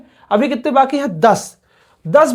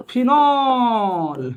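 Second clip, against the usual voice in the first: "Phenol" with a long, stretched last syllable; drawn-out pronunciation; much slower than usual. Shown as a demonstration. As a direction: stretch out the end of "phenol" for the class. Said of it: "मैंने भी बोल दिया". ("Phenol" को लंबा खींचकर बोलना)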